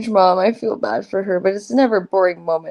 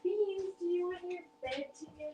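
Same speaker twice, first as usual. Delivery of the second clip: soft voice to pet; 9 semitones higher, 19 dB quieter than usual. Real, not acted. Talking softly to cat.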